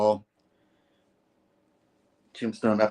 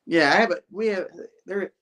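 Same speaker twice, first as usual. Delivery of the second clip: laughing; 7 semitones higher than usual. Real, not acted. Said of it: "Can't even talk".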